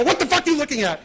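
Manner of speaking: a sudden high, loud yell into the mic with a laugh; mock shout. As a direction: bark it at the manager to startle him. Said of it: "I go".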